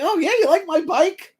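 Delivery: high-pitched, pleased surprise; acted delight. Bike owner getting a compliment, high and delighted. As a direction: play someone thrilled by a compliment, voice way up.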